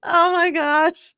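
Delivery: high, slow, tearful-sounding oh my gosh; overwhelmed squeal. Real, not acted. Host overwhelmed by the chat, high and slow. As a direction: squeal it out, overcome.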